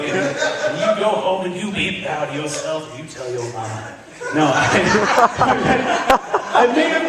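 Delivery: normal talking voice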